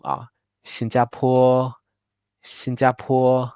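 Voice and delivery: 新加坡 with 坡 stressed most; light 新, medium 加, strongest 坡 in 新加坡; stress on last syllable. Shown as a demonstration. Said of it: "the medium light stress rule".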